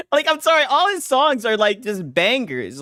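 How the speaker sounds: high, loud amused protest; excited high reaction. Real, not acted. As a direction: gush through leftover laughter. Laughing reaction after song.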